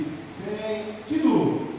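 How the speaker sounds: "Sentido" bem arrastado, alto e agudo; resposta do grupo. Shown as a demonstration. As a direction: alongue a primeira sílaba e solte forte. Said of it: "O grupo diz". ("Sentido" gritado em ritmo lento de tropa)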